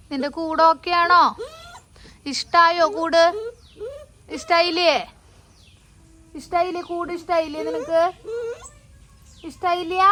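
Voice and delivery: high, coaxing questions to a pet. Real, not acted. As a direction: owner asking the pet if it likes its cage, high and pleading. Asking pet if it likes cage.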